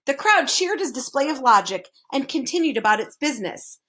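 plain reading voice

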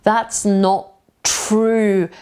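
slow "That's not true" with "not" said as "no"; dropped t. Shown as a demonstration. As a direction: let the t of "not" vanish into "true". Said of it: "To ty w wymowie tylko zniknie tutaj".

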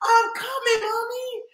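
put-on little girl voice. Journey calls back to Mommy in a high child voice. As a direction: squeak it out like a small girl.

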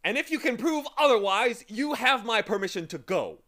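high, loud grand wizard voice; booming magician. Acted. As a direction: lay down the challenge loudly. Magician challenges Milo.

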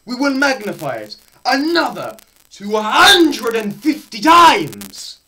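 normal talking voice

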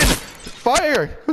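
high sharp yell; urgent shout. Real, not acted. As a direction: yell it in panic. Yelling at teammate mid-fight.

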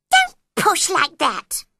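high, loud little-animal complaint; cross Dormouse. A narrator's reading. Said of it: "said the Dormouse crossly".

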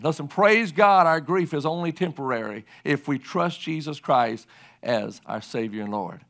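ordinary delivery

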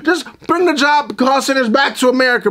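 ordinary talk, nothing marked

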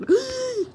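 high, sharp gasp; startled gasp. Real, not acted. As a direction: suck in air in sudden alarm. Startled during game play.

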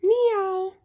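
cat meow. Acted. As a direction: mew it like a cat. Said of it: "cats say?".

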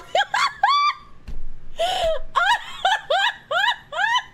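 long, very high peals of laughter; helpless laughter. Real, not acted. Reader cracking up at the decoy's brush-off, helpless laughter. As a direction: laugh uncontrollably, high and squealing.

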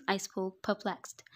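normal talking voice

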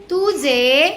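"तुझे" stretched slowly, lifting at the end; leading prompt. Shown as a demonstration. Teacher coaxing a child to repeat after them, leading prompt. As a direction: draw out "तुझे" as an unfinished cue.

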